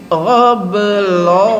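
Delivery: sung-out slow "Gha belum" with a held guttural gha; gha with sukun. Shown as a demonstration. Teacher drilling a student on the guttural gha. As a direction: stretch "Gha" long like a Quran recitation. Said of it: "gha berharokat dengan gha sukun".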